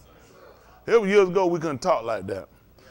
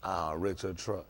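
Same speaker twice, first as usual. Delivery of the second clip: much lower, much softer, slow mumble; a hint of a guilty friend owning up; sheepish confession; 13 semitones lower, 13 dB quieter, slower than usual. Friend quietly admitting he wrecked the truck, slow and much softer.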